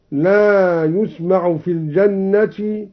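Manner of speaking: ordinary talk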